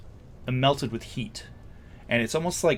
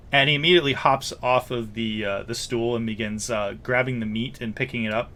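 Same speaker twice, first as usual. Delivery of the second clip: usual speaking voice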